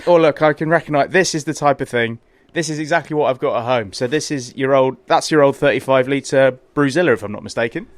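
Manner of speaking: ordinary delivery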